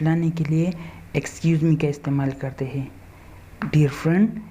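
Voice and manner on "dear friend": ordinary talk, nothing marked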